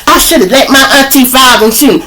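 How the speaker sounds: high, loud, scornful complaint; disgusted. Retold or imitated. Baby mama complaining to him about her auntie, high and disgusted. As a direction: spit it out with disgust. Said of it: "she said that with such disgust".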